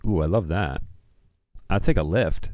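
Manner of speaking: ordinary talk, nothing marked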